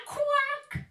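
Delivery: very high loud quack. Acted. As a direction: squawk it out. Animal sound.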